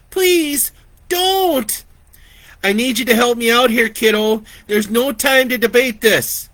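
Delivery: high, loud and fast, begging; a frightened child begs her sister for help; desperate pleading. Acted. Girl pleads with her sister, high and panicked. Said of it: "Lori pleaded with her".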